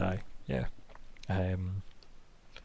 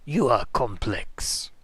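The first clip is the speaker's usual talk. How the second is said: slow, low necromancer drawl; sneering gloating dark voice; 4 semitones lower, 3 dB quieter, slower than usual. Acted. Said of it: "I have one necromancer voice".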